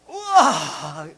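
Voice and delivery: long, drawn-out gasp of "wow"; awed crowd reaction. Retold or imitated. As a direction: imitate a bowled-over audience, breath drawn out. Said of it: "이렇게 됐어요". (감탄하며 길게 우와)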